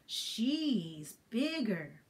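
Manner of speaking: put-on slow boy. Boy voiced in story.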